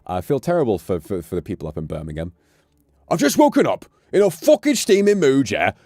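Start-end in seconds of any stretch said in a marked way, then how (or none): 3.1-5.7 loud, high, angry Brummie rant; Brummie accent. Retold or imitated. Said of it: "Birmingham accents".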